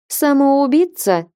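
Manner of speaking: high sarcastic question, a bit slow; mock the idea with sarcasm; open sarcasm. A narrator's reading. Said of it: "Сарказм и не думала скрывать".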